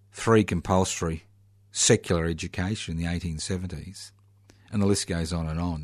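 normal talking voice

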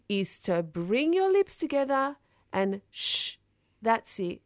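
rhythmic, dramatic poem delivery with hushing; spoken word performance. Acted. Poet performing her piece on the radio, dramatic spoken word. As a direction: perform it like a slam piece on stage.

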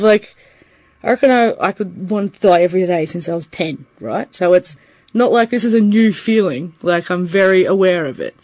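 normal talking voice

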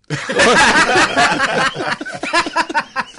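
long, high, loud laughter. Real, not acted. Laughing at his own Frankston story to the mates. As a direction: roll with laughter at your own joke.

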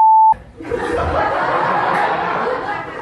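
big bursting laugh; real laugh at her own joke; burst of laughter. Real, not acted.

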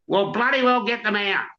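high, insistent acted order; demanding. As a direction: demand it urgently. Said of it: "Tom demanded".

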